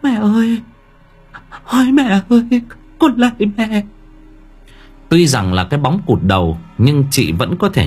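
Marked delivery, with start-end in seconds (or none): 0.0-3.8 much higher, trembling terrified plea; frightened daughter's shaky cry; stammering in fear. Acted. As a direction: stammer to your dead mother, terrified. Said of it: "Chị Yến lắp bắp".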